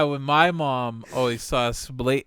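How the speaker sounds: usual speaking voice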